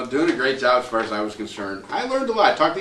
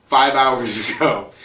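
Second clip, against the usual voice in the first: laughing; 4 dB louder, slower than usual. Retold or imitated. Same excuse, punchline with laugh.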